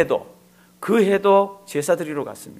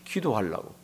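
soft answer; 6 semitones lower, 9 dB quieter than usual. Real, not acted. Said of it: "한나가 오늘은 왜 일어나죠?".

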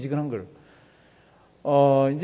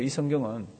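normal talking voice

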